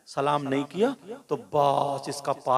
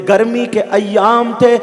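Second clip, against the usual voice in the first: projected, chant-like sermon storytelling; rousing Urdu preacher's narration with swelling peaks; full-force preaching; 8 semitones higher, 13 dB louder, slower than usual. Acted. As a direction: carry each phrase out to the crowd, stretching the endings.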